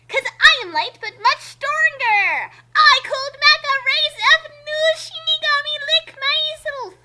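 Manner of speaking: high, bragging character voice; boastful character. Acted. Character boasting to Evian, high and proud. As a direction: brag it as an overpowered hero.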